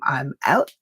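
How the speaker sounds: normal talking voice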